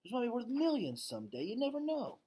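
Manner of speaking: put-on a girl half-joking to her friend; high child's voice, light and playful; young girl. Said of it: "Julia says".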